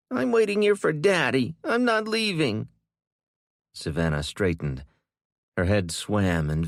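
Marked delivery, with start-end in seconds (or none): 0.1-2.7 high, loud tearful defiance; child waiting for her daddy; stubborn little girl. A narrator's reading. Child refuses, wants father.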